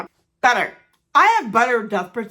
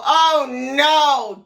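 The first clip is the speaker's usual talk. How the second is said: loud, dismayed gasp of a line; shocked at seeing the bruise; mock dismay; 3 semitones higher, 8 dB louder than usual. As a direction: react with sudden horror at the bruise. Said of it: "and I go".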